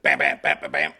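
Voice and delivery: low, loud vocal gunshots; low loud mimicked gunshots; gunshot noises. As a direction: bang out the shots low. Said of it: "Southern horror story".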